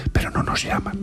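hushed, breathy confiding whisper. Real, not acted. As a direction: drop to a whisper for the last words. Confiding bitter punchline.

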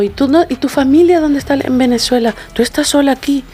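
put-on concerned older friend. Friend's mother asks lone young musician about family, motherly concern.

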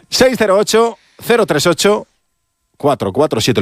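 "Seis cero ocho", pause, "cero tres ocho", pause, "cuatro cuatro siete"; high on "Seis cero ocho, cero tres ocho", dropping on "cuatro cuatro siete" after a pause; silencios entre números. Shown as a demonstration. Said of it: "los silencios entre números". (con silencios solemnes entre "Seis cero ocho", "cero tres ocho" y "cuatro cuatro siete")

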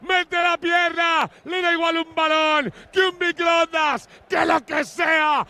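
slow, stretched shouted goal call; breakneck goal commentary. Real, not acted. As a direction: belt it out, stretched and high.

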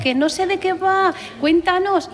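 imitating anxious callers, high-pitched; gente que llama apurada. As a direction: raise the voice high, like a flustered caller. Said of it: "la gente nos llama". (imitando a gente apurada, voz aguda)

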